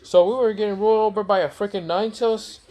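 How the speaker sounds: high-pitched outraged disbelief; horrified villagers. Acted. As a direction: put on a high, appalled crowd voice. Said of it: "they're, like, horrified".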